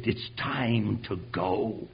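low, slow and soft divine command; God quietly summoning him to preach. Acted. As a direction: speak it slowly, low and soft, as God calling. God's voice speaking to him.

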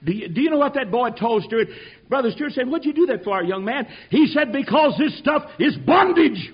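fiery sermon shout, drawing out the key words; full-force preaching. Acted. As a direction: shout it out like a revival preacher, loud and high.

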